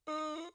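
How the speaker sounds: small, high-pitched whimper; quiet, high held "uh"; reaction noise. Retold or imitated. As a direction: let out a tiny worried squeak. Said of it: "I'm like".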